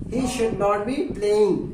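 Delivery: very slow, each English word spelled out for learners; slow model sentence. Shown as a demonstration. Teacher showing students the English sentence, very slowly. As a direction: dictate it word by word. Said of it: "उसे नहीं खेल रहा होना चाहिए".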